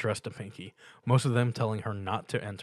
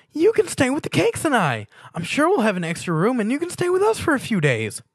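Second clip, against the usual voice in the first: much higher and louder, bursting with glee; excited pony; 9 dB louder than usual. A narrator's reading. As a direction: gush the invitation like a thrilled cartoon pony. Pinkie excitedly invites Stuart.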